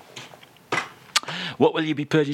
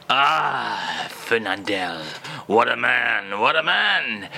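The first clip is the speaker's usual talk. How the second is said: hammed-up French accent, lightly lower; mock French accent; 6 semitones lower than usual. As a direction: play the French crooner in a comic French accent. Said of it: "[name] is here".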